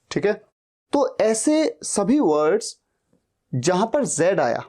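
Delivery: ordinary talk, nothing marked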